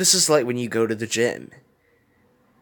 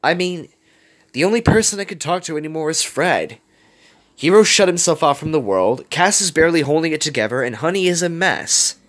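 higher, urgent outpouring; impassioned plea; 7 semitones higher, 4 dB louder than usual. A narrator's reading. Wasabi tells Gogo their friends are falling apart, impassioned. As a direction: press the point, voice raised in pitch.

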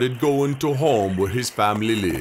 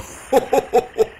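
high laugh; 11 semitones higher than usual. Acted. Spirits laugh after song.

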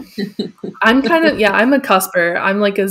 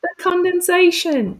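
high-pitched quote; 10 semitones higher than usual. Retold or imitated. Quoting show, high voice.

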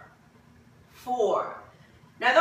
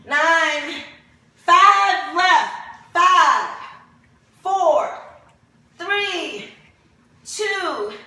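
strained, louder counting; straining through crunches; 4 semitones higher, 5 dB louder, much slower than usual. Real, not acted. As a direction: push the count out under effort. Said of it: "Three is burning".

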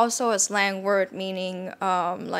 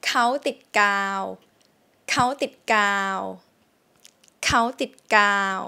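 dictation sentence; much faster than usual. Shown as a demonstration. Teacher reading a test sentence for students. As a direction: spell out เขาติดกาว. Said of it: "I'm gonna say a sentence".

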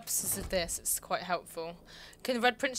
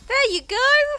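mimicking a kids' TV host, very high and loud; hammed-up children's presenter cheer; 12 dB louder, slower than usual. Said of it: "proper kids' T V presenter voice".

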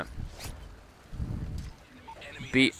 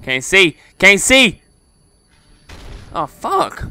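panicked yelp; 7 semitones higher, 6 dB louder, slower than usual. Real, not acted. Flashed in game.

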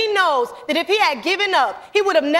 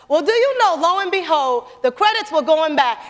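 normal talking voice

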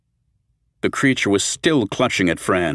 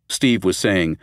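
plain reading voice

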